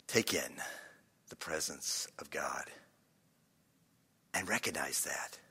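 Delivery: low, hushed, with long pauses; guided meditation. Shown as a demonstration. Preacher guiding people to God's presence, hushed meditative voice. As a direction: settle quietly into reverent stillness. Guiding congregation through breathing prayer.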